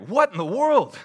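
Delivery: high, loud mock disbelief; baffled outburst. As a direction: exclaim it, incredulous at the absurd turn. Mock bafflement at film's jarring turn.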